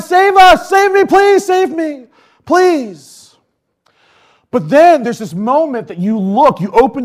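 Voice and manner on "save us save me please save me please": higher, louder pleading cry for rescue; mock shout for help. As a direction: cry out like a drowning child. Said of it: "you're shouting out".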